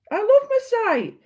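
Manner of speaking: high, sung-out declaration; sing-song delight. Real, not acted. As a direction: coo it happily like a little song. Showing off her website.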